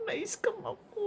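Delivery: high, soft teary squeak, imitating herself; retold near-tears. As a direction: replay the moment you almost cried. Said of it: "naiiyak na ako". (parang naiiyak, mataas at mahinang boses)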